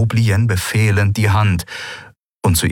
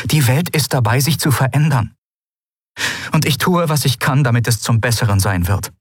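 put-on higher, earnest young woman; Lien, determined; 7 semitones higher than usual. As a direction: promise to fight for the better. Lien's voice, higher. (Lien, entschlossen, höhere Stimme)

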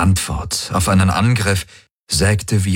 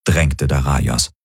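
usual speaking voice

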